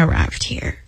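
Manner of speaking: dropping to a whisper; a real whispered aside. Real, not acted. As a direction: hush it as a confession. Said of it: "unvoiced whisper".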